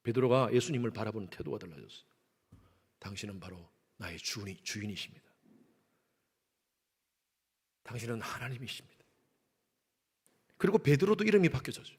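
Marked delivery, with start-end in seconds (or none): 3.0-9.0 hushed, much softer confession of faith; bow before your master and say it low; Peter, quietly and devoutly confessing his Lord; reverent Peter. Retold or imitated. Peter's new reverent attitude.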